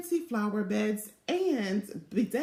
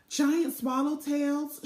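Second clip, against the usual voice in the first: ordinary delivery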